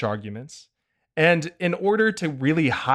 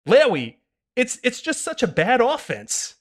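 put-on higher whiny know-it-all kid; 11 semitones higher than usual. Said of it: "no fun kid voice".

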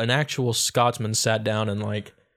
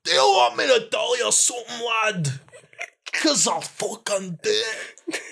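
threatening Scottish; 11 semitones higher than usual. Pretend. Facing a fight, she bluffs in a high mock brogue. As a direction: bluff a fighter off as a wild old Scot. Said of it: "feel like someone's about to beat me up".

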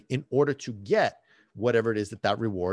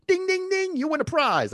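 high sing-out prize bell imitation; mock game-show winner. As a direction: chime like a quiz bell, much higher.

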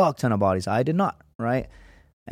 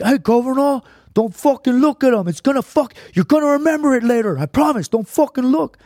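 put-on high, loud Cuban-accented squad leader; 12 semitones higher, 6 dB louder than usual. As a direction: bark it like a Cuban sergeant. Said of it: "he's got this Cuban accent".

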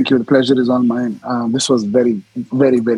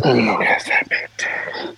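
whisper. Real, not acted. Intimate encounter, aroused whisper.

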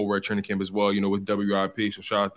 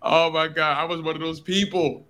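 excited; 6 semitones higher than usual. Real, not acted. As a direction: burst out with exasperated amusement. Laughing admission after co-host remark.

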